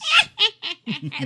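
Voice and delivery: high shrieking cackle; witch laugh. Acted. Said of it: "That was my witch laugh".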